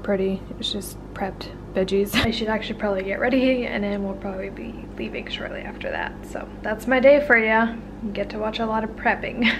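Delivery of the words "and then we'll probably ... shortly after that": quick whispered aside; whispering. Real, not acted. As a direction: say it under your breath.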